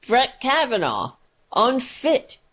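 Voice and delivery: ordinary talk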